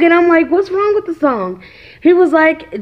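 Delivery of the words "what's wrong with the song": high-pitched retold bewilderment; mildly exaggerated disbelief; puzzled mom. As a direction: replay a baffled question to a child. Mother questions crying son.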